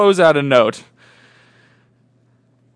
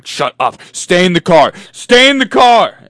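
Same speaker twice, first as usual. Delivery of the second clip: loud barked order, building; acted yell; 5 semitones higher, 10 dB louder than usual. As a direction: yell it at your panicking friend. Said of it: "is what Kyle proceeds to yell".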